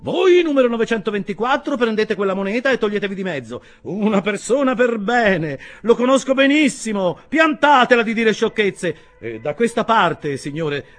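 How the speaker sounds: high, loud stream of fragments; take-charge stranger holding forth loudly; voluble nonstop talker. A narrator's reading. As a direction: rattle off orders and reassurances to all around. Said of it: "dette con straordinaria volubilità".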